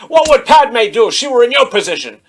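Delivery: heated mock argument; mock stern scolding. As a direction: shout the rebuke as a hammed-up mentor. Acts out Obi-Wan scolding Anakin.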